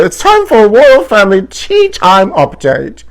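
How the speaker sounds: slow, high, loud show-host voice; hammed-up announcer. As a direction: sell the segment like a game show. Continues hammed-up announcer headline.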